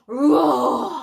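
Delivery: huge loud roaring cry; lion roar. A narrator's reading. Storyteller roars as the father lion scares the baboons. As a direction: let out a mighty lion roar.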